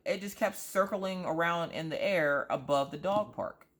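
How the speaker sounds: ordinary talk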